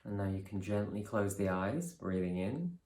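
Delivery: calm, measured guidance with long pauses; guided meditation. Real, not acted. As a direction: ease them through each breath, unhurried and softening. Leads a breathing meditation.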